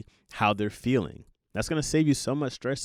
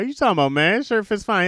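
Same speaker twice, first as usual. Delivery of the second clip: indignant protest; 9 semitones higher, 6 dB louder than usual. Retold or imitated. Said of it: "And I was like".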